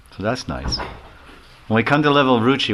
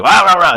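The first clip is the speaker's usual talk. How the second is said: loud high mock dog barks, rah rah rah; dog barking; 7 semitones higher, 8 dB louder than usual. Storyteller barks as the dog at a passing sadhu. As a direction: bark like an angry yard dog. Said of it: "the dog would just bark".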